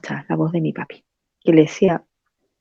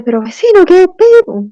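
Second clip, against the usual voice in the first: shocked question; 10 semitones higher, 4 dB louder than usual. Retold or imitated. Neighbour's daughter sees the dead father, shocked outburst. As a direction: pitch jumps high and loud on qué pedo.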